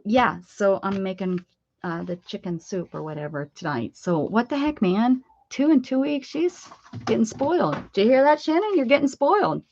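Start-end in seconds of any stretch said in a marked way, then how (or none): 4.2-6.3 higher, half-joking exasperation; playful outrage. Real, not acted. Mom teasing Shannon, who got two lasagnas, with playful outrage. 6.3-9.6 high, sing-song ribbing; teasing. Real, not acted. Mom calling out to Shannon that she's spoiled, high and teasing.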